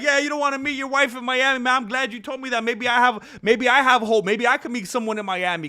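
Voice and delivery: high, quick teasing imitation; fast, jokey mock enthusiasm; joking, mocking banter. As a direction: rib him as one of the guys. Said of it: "They were laughing".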